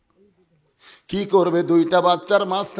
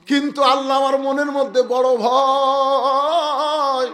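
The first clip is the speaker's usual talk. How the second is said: high, drawn-out cry to God; pleading lament; 8 semitones higher, slower than usual. Acted. Ali confides his fear to Allah, pleading lament. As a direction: chant the fear upward, stretching the last word.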